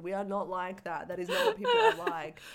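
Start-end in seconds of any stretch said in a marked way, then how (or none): 1.2-2.4 high squeaky laughing protest, faster. Real, not acted. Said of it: "laughing protest".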